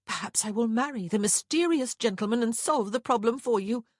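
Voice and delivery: high, loud, sarcastic retort; bitter. A narrator's reading. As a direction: pour out bitter compliance, pitched high. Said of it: "Prudence said bitterly".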